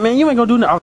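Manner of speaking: high mocking voice of the other guy; mocking quote. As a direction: mimic him high and taunting. Retold threat in a quarrel.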